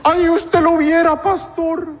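high-pitched, hammed-up besotted girlfriend; mock pleading, pitched well up; lovestruck congregant. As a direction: gush to the pastor in a high put-on voice. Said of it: "Hay gente aquí que dice".

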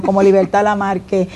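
ordinary delivery

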